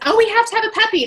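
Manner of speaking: high, quick imitation of an eager mom; acted excited pleading. Acting out mom backing the child.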